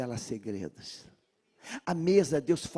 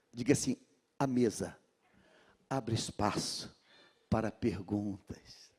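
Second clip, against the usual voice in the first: normal talking voice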